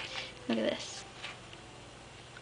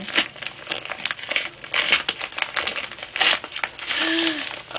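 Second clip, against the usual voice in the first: long unvoiced gasp of awe; long breathy gasp; 5 semitones higher, 5 dB quieter than usual. Real, not acted. Seeing the butterfly album, long breathy gasp of awe. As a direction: draw in breath in wonder.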